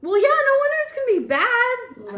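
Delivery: high, loud incredulous scolding; no wonder, in disbelief; exasperated. Real, not acted. Exasperated at spitting in cup.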